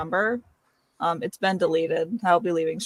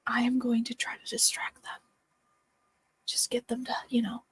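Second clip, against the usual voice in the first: hushed sneaky plan; whisper; 2 semitones higher, 5 dB quieter than usual. Acted. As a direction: whisper it so no one hears.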